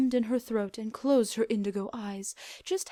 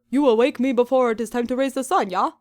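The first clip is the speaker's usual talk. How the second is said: Celestia voice; 3 semitones higher, 8 dB louder than usual. Acted.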